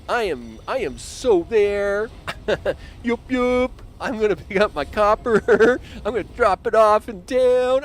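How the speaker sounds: high, loud, gleeful train worker; happy train driver. Pretend. Gamer voicing his copper train driver, high and gleeful. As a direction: cheer like a proud little driver showing off. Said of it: "He's like".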